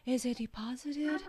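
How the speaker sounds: thin, hollow, trembling question; hollow vibrating voice. Shown as a demonstration. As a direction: ask it shaky and empty. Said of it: "Hollow是非常空洞的".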